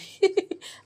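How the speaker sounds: brief high giggle. Real, not acted. Cook admitting she shreds by hand, giggling. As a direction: giggle lightly, high in pitch. Amused at lacking a food processor.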